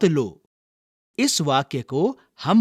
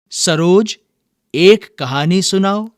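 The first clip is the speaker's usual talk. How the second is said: louder, higher classroom call; 4 semitones higher, 6 dB louder than usual. Shown as a demonstration. Teacher models a command for the class to repeat, calling out. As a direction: call on the student to tell a story. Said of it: "अब मैं कुछ वाक्य बोलूंगा".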